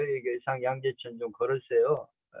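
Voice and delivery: ordinary talk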